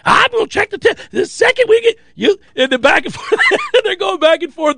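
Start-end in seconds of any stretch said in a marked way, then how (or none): 0.0-2.4 high heated yell; mock yell. Coach yelling at quarterback, mock heated yell. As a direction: threaten to check it.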